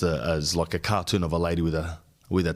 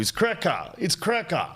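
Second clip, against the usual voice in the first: mocking a trendy word, pitch a little higher; satirical mockery; 9 semitones higher than usual. Podcaster mocking a trendy word to his listeners, a touch of a sneer. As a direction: sneer lightly at "cracker" with a falling tune. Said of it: "satirizing that stupid word".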